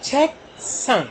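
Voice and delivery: slow high "छेक", gap, falling "संग"; syllable by syllable. Shown as a demonstration. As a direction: model "छेक संग" as two separate syllables. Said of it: "दो syllable से मिलकर". ("छेक संग" को धीरे, तोड़कर)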